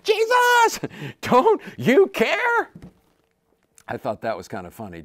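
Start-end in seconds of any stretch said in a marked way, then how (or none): 0.0-2.7 high, loud, drawn-out cry over the storm; terrified disciples scream at the sleeping Jesus; screaming plea. Retold or imitated. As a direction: yell it up high, stretching care. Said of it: "they're probably screaming".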